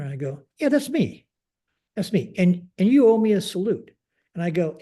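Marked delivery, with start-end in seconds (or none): 0.6-3.9 higher, emphatic self-identification; pointed assertion. Retold or imitated. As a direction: pull rank on a subordinate, retold. Commander confronts non-saluting airman.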